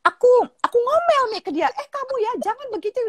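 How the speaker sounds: very high imitated girlish scolding; nagging daughter. Daughter recounts scolding the boy, high imitated nagging. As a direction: act out a child's squeaky telling-off. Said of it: "Panjang sekali kan dia ngomelnya".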